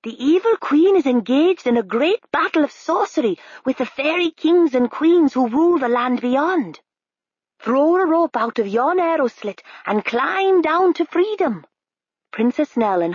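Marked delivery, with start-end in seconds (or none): 0.0-11.6 high bird voice; high eager raven. A narrator's reading. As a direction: urge them on as a helpful bird. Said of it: "said the raven".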